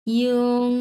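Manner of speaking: ইয়ং said as "yong" with a y-glide, not "ing"; ইয়ং as a clear "yong"; correct pronunciation. Shown as a demonstration. Teacher models the right reading of ইয়ং for students. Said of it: "এটার উচ্চারণ হচ্ছে ইয়ং".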